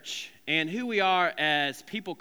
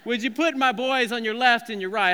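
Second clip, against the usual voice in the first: a hammed-up Italian mama, higher and louder; Italian mama accent; 6 semitones higher, 7 dB louder than usual. Mother begging Jesus for her sons, hammed-up Italian mama accent. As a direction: plead like a proud Italian mother. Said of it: "I don't know why she's Italian now".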